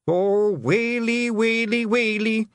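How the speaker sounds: high, drawn-out Scottish lament; hammed-up keening despair; wailing. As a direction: wail each word long and high. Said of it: "wails and groans of the Nac Mac Feegles".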